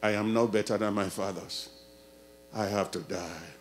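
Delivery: low, soft hopeless voice; despairing Elijah. Retold or imitated. Elijah giving up on life, low and soft. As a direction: sink into despair. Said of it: "Elijah said".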